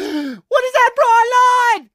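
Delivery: high, loud, slow alarmed cry; startled cry. Acted. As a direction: gasp it out in shock. Startled soldier sees the angel.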